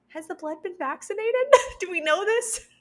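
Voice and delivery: high, laughing quoted challenge; laughing question. Retold or imitated. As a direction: ask it half-laughing.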